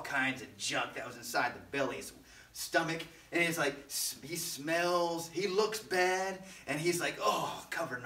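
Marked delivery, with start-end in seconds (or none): none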